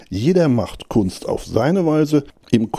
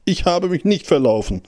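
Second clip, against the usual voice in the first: usual speaking voice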